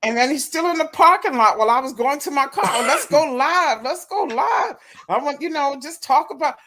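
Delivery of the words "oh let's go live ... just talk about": high mock insistence. Mimicking him in parking lot.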